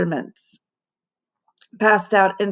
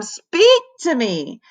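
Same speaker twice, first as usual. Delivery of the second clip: begging; 5 semitones higher, slower than usual. A narrator's reading. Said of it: "begged Ham".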